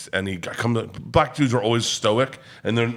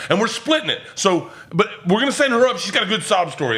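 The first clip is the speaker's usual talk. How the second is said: imitated high, pushy insistence; mock-bossy staff laying down terms; aggressive scheming; 9 semitones higher than usual. Wait staff pushing their plan on the comic, aggressive. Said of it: "it got aggressive, though".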